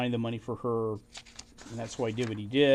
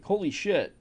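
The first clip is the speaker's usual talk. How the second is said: higher, stunned curse; shocked swear; 7 semitones higher, 3 dB louder than usual. Retold or imitated. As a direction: blurt it out as if floored.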